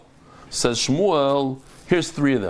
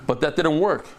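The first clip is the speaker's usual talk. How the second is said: normal talking voice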